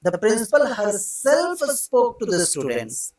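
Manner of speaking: very slow, leaning on herself; teacher emphasizing the reflexive word; stress on herself. Shown as a demonstration. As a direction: draw out herself as the key word. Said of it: "herself ला के जोर दिया".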